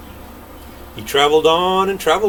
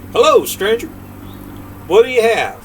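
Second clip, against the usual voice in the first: voiced king; 9 semitones higher, 4 dB louder than usual.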